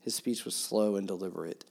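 ordinary talk

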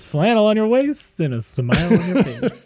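high, loud, laughing mock announcer voice; laughing mock singer. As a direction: ham it up with a laugh. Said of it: "They probably say it like this".

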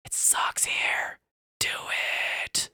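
slow, bleak whisper; sad-boy whisper. Acted. Narrator voicing the vibes of the place, bleak whisper. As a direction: whisper it drawn out, like gloom in the air. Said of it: "The vibes".

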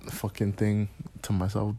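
ordinary talk, nothing marked

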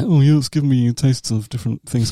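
lightly mocking a pretentious taster; a hint of a snooty connoisseur; understated mock beer-snob voice; wanky beer snob. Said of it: "I'm not gonna go wanky and say".

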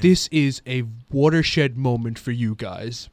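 low, soft, measured pronouncement; grave fatherly advice, put on; quiet mock-solemn wisdom; imitated sage dad. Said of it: "sits back and he goes".

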